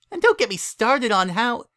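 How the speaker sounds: put-on high, childish critic voice; a little filly picking the story apart; know-it-all filly, disgusted. A narrator's reading. Twilight critiques the bedtime story to her sitter, scornful.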